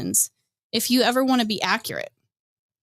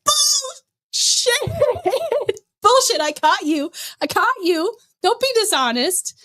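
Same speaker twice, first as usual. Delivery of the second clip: calling him out; 10 semitones higher, slower than usual. Retold or imitated. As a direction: pounce on the lie, half laughing. Calling out Edwin in argument.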